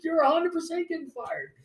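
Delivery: very high, strained mock threat, easing in volume; high-pitched indignant retelling; angry insistence. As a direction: reenact a heated threat high in the voice. Acting out his phone quarrel.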